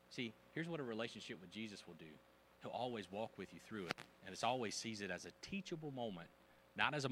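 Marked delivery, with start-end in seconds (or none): none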